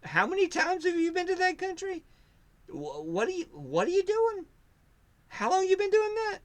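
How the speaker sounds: high, astonished rapid-fire questions; amazed questioning. Retold or imitated. Curious people quizzing him about his travels, high and amazed. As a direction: act stunned at what you hear. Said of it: "they go".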